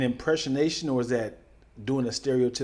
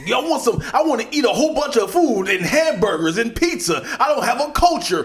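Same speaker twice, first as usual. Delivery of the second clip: loud, high, hammed-up American slang; mock American accent; 9 semitones higher, 7 dB louder than usual. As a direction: play a Russian badly faking American. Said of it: "I'm an American".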